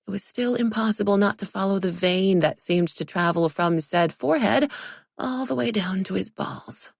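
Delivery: ordinary delivery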